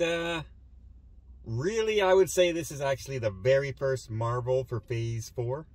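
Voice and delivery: ordinary talk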